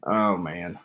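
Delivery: normal talking voice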